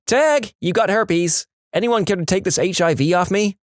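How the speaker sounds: high, mock game-of-tag call; playful tag player. Player taunting others in a game of tag, high mock-playful call. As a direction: sing out like a kid playing tag, hammed-up.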